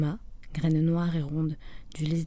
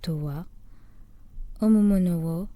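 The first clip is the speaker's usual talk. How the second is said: measured, ceremonial poetry reading in Japanese; poem recital. Acted. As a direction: recite the waka, lifting and holding each phrase.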